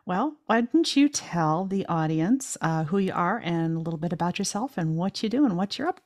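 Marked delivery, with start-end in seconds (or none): none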